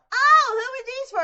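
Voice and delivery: put-on high, loud little girl voice; imitating little sisters. Little sisters eyeing the Nerf guns, high kid voice. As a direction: squeal it like a curious kid. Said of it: "my little sisters were like".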